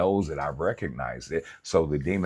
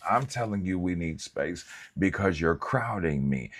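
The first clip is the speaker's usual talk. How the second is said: usual speaking voice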